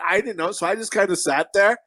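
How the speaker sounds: ordinary delivery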